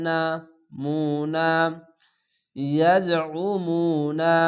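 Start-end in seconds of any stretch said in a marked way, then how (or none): 2.6-4.4 ইয়াজউমুনা with a throat-pressed ain and the মু held one alif; slow tajweed reading. Shown as a demonstration. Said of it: "পেশের পাশে অসাকিন এক আলিফ টেনে পড়বেন". (ইয়াজউমুনা ধীরে তাজবিদসহ)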